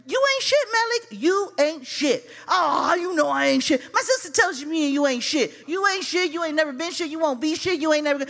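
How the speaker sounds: high-pitched, relentless taunting; acted heated quarrel. Sister yelling back in quarrel.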